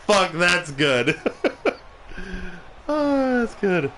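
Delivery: laughing hard, high-pitched; cracking up. Real, not acted. Streamer amused by their own parody, laughing. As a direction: delight spilling into laughter.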